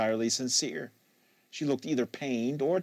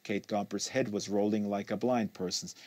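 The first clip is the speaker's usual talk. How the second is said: usual speaking voice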